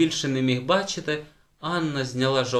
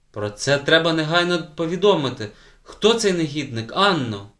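loud, raised-pitch outrage; indignant demand; 5 semitones higher, 5 dB louder than usual. A narrator's reading. Teacher demanding the culprit from the girl, indignant. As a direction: demand a name, loudly. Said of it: "обурилася вчителька".

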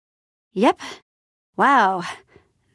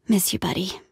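very soft, sighing sadness; hushed, sighed goodbye; wistful farewell; 4 semitones lower, 6 dB quieter than usual. Acted. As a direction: murmur it tenderly to a lost friend. Mourning a lost pig friend.

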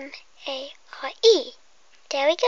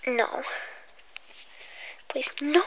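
normal talking voice

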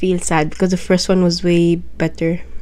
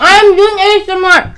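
high, loud protest; exasperated shout; 12 semitones higher, 6 dB louder than usual. Real, not acted. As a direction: yell it in frustration. Protesting interruption.

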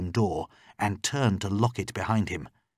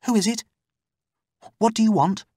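high, louder anxious questioning; much higher voice, challenging the stranger; wary captive woman; 13 semitones higher, 5 dB louder, faster than usual. Acted. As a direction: call warily through a locked door. Locked-in woman answers knock.

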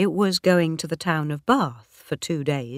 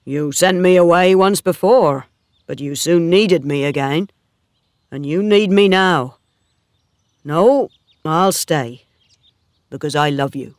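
louder, firm suitor's voice; lightly voiced Oak, steadfast; 7 dB louder than usual. Oak refuses to leave Bathsheba, lightly voiced and firm. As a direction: lightly play a steadfast farmhand, voice raised.

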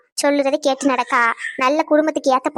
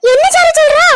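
loud, much higher shocked cry; 13 semitones higher, 9 dB louder than usual. Acted. Mother hears her son will marry secretly, shocked loud cry. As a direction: burst out in disbelief. Shock at register marriage news.

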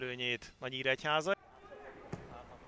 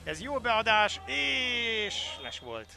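excited sports call; 9 semitones higher, 8 dB louder, much slower than usual. Real, not acted. Chance then offside.

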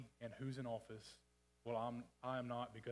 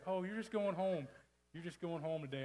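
mock excuse; 6 semitones higher, 6 dB louder than usual. Retold mocking excuse, sarcastic quote.

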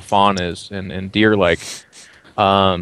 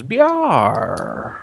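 throaty pirate R; 4 semitones higher than usual. Acted. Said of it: "gets kinda lost in his throat".

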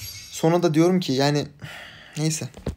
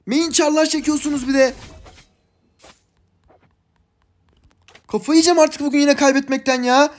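much higher and louder, whining rage; high-pitched angry complaining; frustrated rant; 9 semitones higher, 4 dB louder than usual. Real, not acted. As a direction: vent at useless teammates in a high, loud whine. Raging at teammates after loss.

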